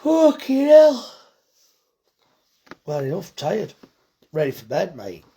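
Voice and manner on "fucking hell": loud, high, drawn-out swear of disbelief; shocked exclamation. Real, not acted. Butcher reading a live comment, loud slow shocked swear.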